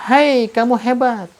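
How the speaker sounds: high shout from far off; echo shout. Retold or imitated. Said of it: "Muncul suara dari kejauhan".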